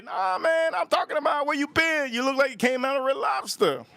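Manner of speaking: high teasing voice of a mocking buddy; mocking friend. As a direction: squeak it out like you're ribbing a sunburnt pal. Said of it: "And then they're gonna say".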